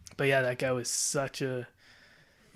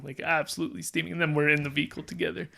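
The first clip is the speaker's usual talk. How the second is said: normal talking voice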